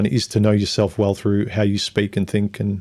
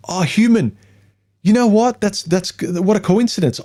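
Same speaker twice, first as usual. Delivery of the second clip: much higher, gushing, sarcastic; mock gushing friend; 9 semitones higher than usual. Best friend gushing agreement in a much higher mocking voice. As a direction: overeager friend agreeing too easily. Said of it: "your friend's like".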